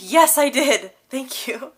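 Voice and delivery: loud exasperated yes. Retold or imitated. To the barista asking again, a loud exasperated reply. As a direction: blurt it out high and loud, fed up but amused. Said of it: "I was like".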